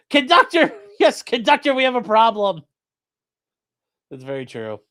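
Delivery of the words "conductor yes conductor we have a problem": much higher, much louder playful shout; joking shout for the conductor; mock call. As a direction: hail the conductor like on a train.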